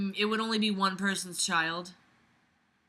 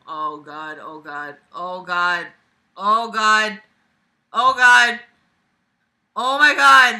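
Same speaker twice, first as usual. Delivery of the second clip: mounting dread; 10 dB louder, much slower than usual. Real, not acted. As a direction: repeat it, building from dread to outcry. Dread on seeing the long note.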